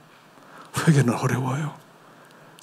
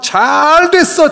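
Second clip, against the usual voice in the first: mock gloat; 11 semitones higher, 4 dB louder, much slower than usual. Mocking gloat in sermon.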